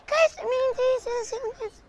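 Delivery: put-on high, quick girly voice; girl voice. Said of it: "I'm in my girl voice".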